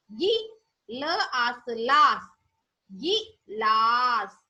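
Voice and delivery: very slow, sounding out each syllable; slow syllable blending. Shown as a demonstration. Teacher sounding out गिलास slowly for pupils to repeat. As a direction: build the word piece by piece, slowly. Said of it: "बोलो".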